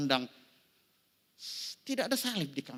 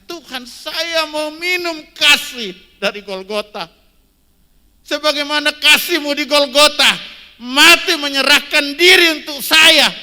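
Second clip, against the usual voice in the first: fervent cry; 13 semitones higher, 23 dB louder than usual. Retold or imitated. Pastor reenacting his daily prayer to God, loud and high. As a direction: cry out to God with all your heart.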